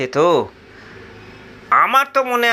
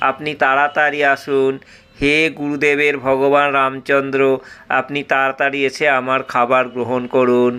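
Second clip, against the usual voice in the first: pleading prayer; 4 semitones lower than usual. Acted. Disciple prays to Rama.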